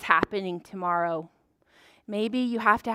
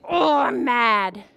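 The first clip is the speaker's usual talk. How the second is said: slow, drawn-out mock growl; pretend mad; 4 dB louder, much slower than usual. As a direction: play at being mad. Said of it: "What do you do when you're mad?".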